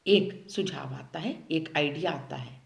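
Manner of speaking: ordinary delivery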